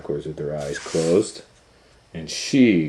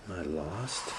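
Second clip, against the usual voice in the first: tiny hushed lost voice; frightened whisper; 15 dB quieter than usual. Acted. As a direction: barely breathe the question, scared.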